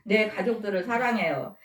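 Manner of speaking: normal talking voice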